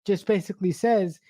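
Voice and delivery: ordinary talk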